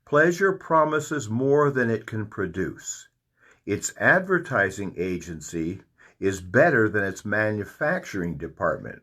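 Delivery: slow, deliberate reading of the quote; read slowly. Shown as a demonstration. Preacher reading the quote slowly to the congregation. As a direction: let each phrase land slowly. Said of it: "I'm gonna read this slowly".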